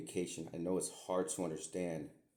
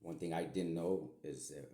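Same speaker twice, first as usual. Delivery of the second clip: ordinary talk, nothing marked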